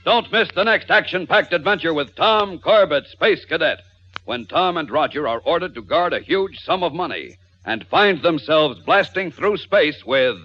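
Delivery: radio announcer. Acted. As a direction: sell the next episode boldly. Announcer's closing promo.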